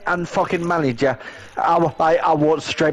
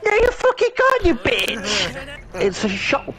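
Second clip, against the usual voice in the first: much higher, a yelled fake retort; mock outburst; 9 semitones higher than usual. Imagined retort to the old coworker, mock shrieked. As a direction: screech the comeback in a put-on shriek. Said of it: "I'd be like".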